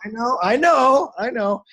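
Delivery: high, drawn-out playful wail; mock commiseration. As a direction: wail along in exaggerated sympathy. Teller mock-commiserates with sobbing driver.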